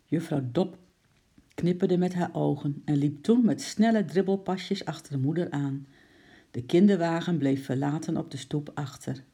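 plain reading voice

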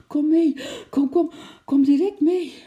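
high, loud, breathless urging; out of breath and calling frantically; panting call. A narrator's reading. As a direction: gasp out an urgent summons. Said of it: "riep Lotje hijgend".

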